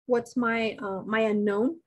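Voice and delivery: usual speaking voice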